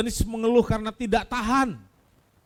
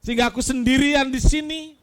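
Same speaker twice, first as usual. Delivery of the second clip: raised, pleading cry; calling out to God; 7 semitones higher, 4 dB louder than usual. Acted. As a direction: call out, louder and higher at the end. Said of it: "dengan berseru".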